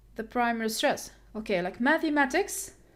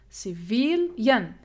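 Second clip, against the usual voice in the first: "civilian" slowly split as ci-VIL-yen; slow teacher's breakdown of "civilian", stretched VIL; syllable by syllable; 3 dB louder, slower than usual. Shown as a demonstration. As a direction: draw out "civilian", separating the final yen. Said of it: "The stress here".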